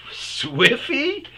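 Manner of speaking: a sudden realization called out; surprised call. Retold or imitated. Calling coworker's nickname, surprised.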